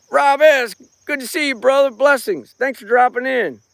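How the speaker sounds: cheery high shout-out to chat names; livestream shout-out. Acted. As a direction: beam a quick hello to viewers. Greets live chat viewers.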